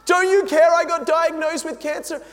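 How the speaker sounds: high, loud, frantic complaining to Jesus; loud mock-desperate wail; whiny panicked plea. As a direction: beg like a worried believer, hammed up. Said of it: "Can you see yourself saying that?".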